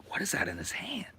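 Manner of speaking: soft, unvoiced whisper; hushed puzzled whisper; curious whisper. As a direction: whisper it, squinting at his hand. Kid peering at Santa's hand.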